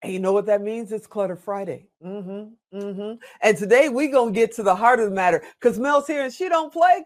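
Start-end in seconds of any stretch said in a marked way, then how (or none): none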